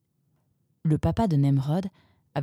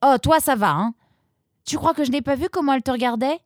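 teasing sister; 9 semitones higher, 4 dB louder, faster than usual. A narrator's reading. Roxane teases her brother.

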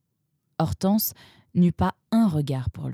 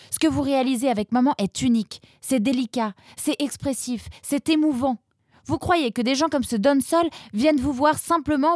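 angry daughter; 8 semitones higher than usual. A narrator's reading. Roxane keeps ranting.

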